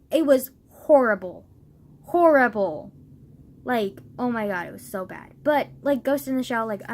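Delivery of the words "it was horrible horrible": emphatic disgust. Real, not acted. Ranting about the live action remake.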